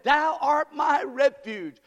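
slow, loud ringing proclamation; preacher declaring the psalm; proclaimed scripture. Acted. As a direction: declare it to the rafters.

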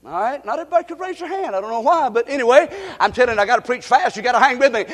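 ordinary talk, nothing marked

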